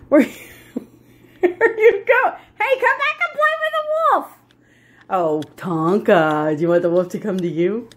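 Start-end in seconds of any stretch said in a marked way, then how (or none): 0.1-2.3 high, slow, laughing call; drawn-out amused pet talk; laughing question. Real, not acted. Laughing as the dog leaves. 2.6-4.3 very high sing-song dog call; high-pitched pet talk; calling the pet back. Real, not acted.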